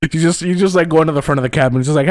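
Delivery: usual speaking voice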